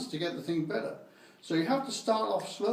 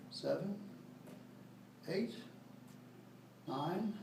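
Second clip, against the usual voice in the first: counting getting softer and softer; counting reps, fading; 2 semitones lower, 13 dB quieter, much slower than usual. Shown as a demonstration. Trainer counts ten lifts, fading to soft. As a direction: let the count drift away as you tire. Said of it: "you do ten".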